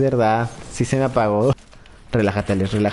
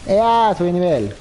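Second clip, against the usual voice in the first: excited cheer; 4 semitones higher, slower than usual. Real, not acted. Levels up in the game.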